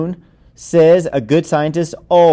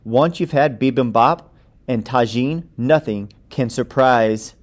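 usual speaking voice

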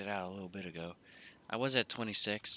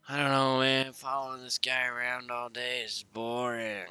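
loud, higher bored groan; 4 semitones higher, 6 dB louder than usual. Real, not acted. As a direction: blurt it out in boredom.

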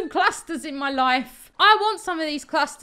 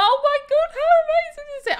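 gushing; 13 semitones higher, 7 dB louder, faster than usual. Real, not acted. Gushing over build.

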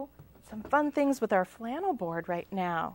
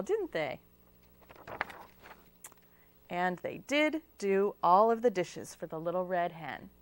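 ordinary delivery

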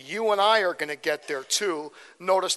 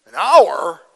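much louder mock disbelief; loud, stunned "An hour?"; incredulous; 13 dB louder than usual. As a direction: react as if you can't believe your ears.

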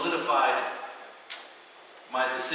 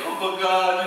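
higher, louder protesting whine; complaining; 4 semitones higher, 6 dB louder, slower than usual. Retold or imitated. Believer still arguing with God, complaining. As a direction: keep whining in protest.

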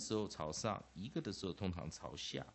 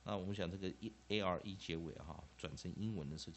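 ordinary delivery

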